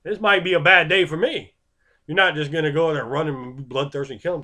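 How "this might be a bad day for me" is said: higher, louder, nervous laugh in the middle; sudden alarm at the odds, half laughing; nervous fighter. Retold or imitated. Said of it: "You're like".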